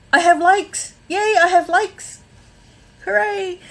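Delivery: high, delighted squeal over likes; excited cheer. Real, not acted. Streamer cheering viewers for sending likes, excited and high. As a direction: celebrate the likes like a small win.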